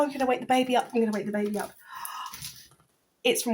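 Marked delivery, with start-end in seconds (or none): none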